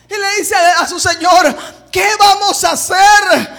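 high, loud cry for help; acted frightened yelling; desperate shout. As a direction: shout to your master in alarm. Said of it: "El hombre pega un grito".

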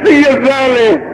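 high, sustained call; host's call-out. Real, not acted. Host passing the contestant after a joke, calling out.

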